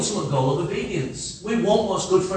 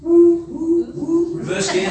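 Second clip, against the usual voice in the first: drawn-out Italian-style call, higher; Italian sound; 9 semitones higher, 4 dB louder, much slower than usual. Retold or imitated. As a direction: stretch it like an Italian. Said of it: "like an Italian sound".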